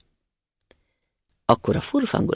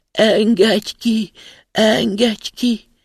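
slow, higher, loud wailing plea; plaintive muffled cry; 7 semitones higher, 5 dB louder, much slower than usual.

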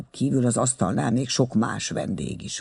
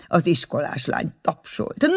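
ordinary delivery, nothing marked

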